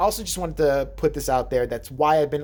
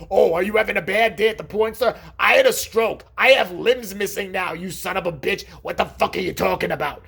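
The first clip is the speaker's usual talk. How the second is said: loud, higher, furious imagined comeback; angry mock rant; 4 semitones higher, 4 dB louder than usual. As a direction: yell it back like the offended uncle. Imagined uncle snapping back angrily.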